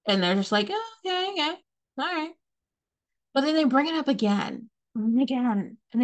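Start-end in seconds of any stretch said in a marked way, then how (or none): 0.7-2.3 much higher, breezy brush-off voice; mock airy reply. Imitating the friend's high, dismissive "okay, all right". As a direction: toss it off high like you couldn't care less. Mocking the other person's reply.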